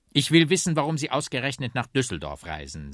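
loud, high exasperated demand; insist, losing patience; annoyed. A narrator's reading. Policeman presses the evasive bagpipe for a reason, annoyed. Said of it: "sagt der Polizist genervt".

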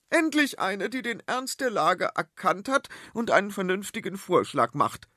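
high, loud, grand queen; queen approving of her daughter, high and loud; imperious queen. Acted. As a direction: pronounce it like royal approval, high.

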